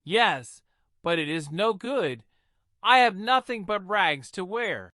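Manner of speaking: put-on understated tearful girl, no real crying; sobbed. A narrator's reading. Cinderella tells the old lady she has only rags, mildly sobbing. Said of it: "sobbed poor Cinderella".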